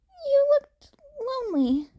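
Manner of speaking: put-on high, earnest teen voice; Izuku, shy and friendly. A narrator's reading. Izuku explains himself to the stranger, high and earnest. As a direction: keep it light and eager.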